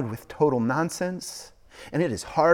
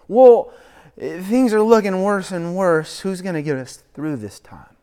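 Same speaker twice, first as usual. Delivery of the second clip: anxious plea; 7 semitones higher, 9 dB louder than usual. Retold or imitated. Voicing an anxious believer.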